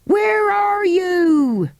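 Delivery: high, slow calling out; shouted. Acted. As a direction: call far up, slowly. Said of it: "shouted Andrew".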